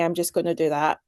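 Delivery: ordinary talk, nothing marked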